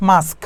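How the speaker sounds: "মাস্ক" with s before a final k; correct pronunciation. Shown as a demonstration. Teacher applying the same rule, "মাস্ক" pronounced with final k. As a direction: close "মাস্ক" on sk, as in "mask". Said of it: "কেটা শেষে আসে না".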